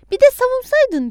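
high, loud, outraged retort; angry shout. A narrator's reading. Adnan cutting off the caller's self-defence, shouting. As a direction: yell over the excuse. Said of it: "diye bağırdım".

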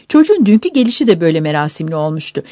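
plain reading voice

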